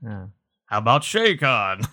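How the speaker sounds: ordinary talk, nothing marked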